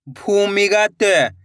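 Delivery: syllables joined into one slow word; blended word. Shown as a demonstration. Teacher joining the syllables for learners, slowly blended. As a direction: read it as one whole, stretched word. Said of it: "इनको आपस में लाकर पढ़ना है".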